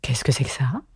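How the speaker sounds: lightly voiced unicorn, low and airy; puzzled creature. A narrator's reading. Unicorn asking about Alice, puzzled and hushed.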